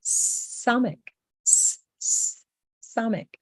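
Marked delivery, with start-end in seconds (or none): 1.5-2.4 "s, s" as a long voiceless hiss. Shown as a demonstration. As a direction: hiss the "s" sound. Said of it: "as in an S for sky".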